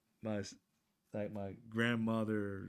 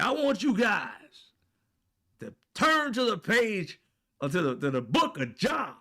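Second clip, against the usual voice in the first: booming pastor; 11 semitones higher, 9 dB louder than usual. Retold or imitated. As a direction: hammer it out from the pulpit. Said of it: "he said".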